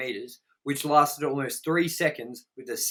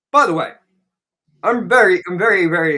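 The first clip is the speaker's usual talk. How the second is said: excited; 5 semitones higher, 10 dB louder than usual. Real, not acted. Loud excited announcement.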